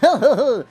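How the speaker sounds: short, high happy laugh. Real, not acted. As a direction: burst into a pleased laugh. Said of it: "you wanna see me happy?".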